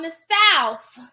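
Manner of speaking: South emphasised, ending in an F; South as "Souf". Shown as a demonstration. Vlogger addresses fellow Southerners, South pronounced "Souf". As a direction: say South with F replacing the final TH. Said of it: "then you know it's S O U F".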